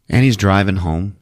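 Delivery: ordinary delivery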